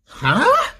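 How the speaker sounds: sharp high rising huh in disbelief; a squeaky, stunned huh?; baffled huh. Real, not acted. Baffled at connection status.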